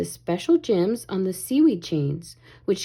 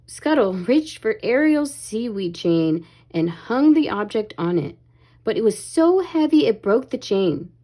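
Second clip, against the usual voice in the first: ordinary delivery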